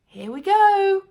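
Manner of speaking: put-on high, loud excited boy; young boy cheering as he feeds grandma; eager cry. A narrator's reading. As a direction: sing it out as the spoon goes in. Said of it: "George cried".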